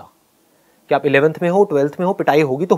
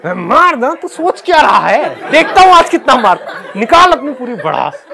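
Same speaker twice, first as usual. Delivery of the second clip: much higher, louder taunting shout, half laughing; defiant yell; 10 semitones higher, 6 dB louder than usual. Retold or imitated. Student daring the trembling PT sir in front of the crowd, defiant yell. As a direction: taunt him at full voice, daring him. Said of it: "मेरा confidence और बढ़ गया".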